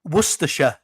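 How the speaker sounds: Worcestershire said as wuster-shuh; British Worcestershire. Shown as a demonstration. Host reads the placename the British way. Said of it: "ones that I've heard Americans pronouncing".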